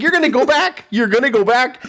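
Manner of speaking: high, teasing singled-out accusation; put-on high voice, playing it for laughs; mock voiceover. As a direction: imitate a streamer taunting the screen. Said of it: "like, doing the voiceover".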